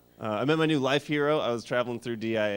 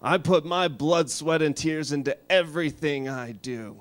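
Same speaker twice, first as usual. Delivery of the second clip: higher, louder, very slow stern grandma; grandma scolding; 5 semitones higher, 5 dB louder, slower than usual. Retold or imitated. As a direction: lecture the kids like a tough old grandma. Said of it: "my grandma's always saying".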